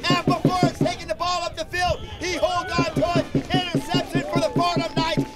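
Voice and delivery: loud, high interception call; excited. Real, not acted. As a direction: shout the turnover with delight. Interception called live.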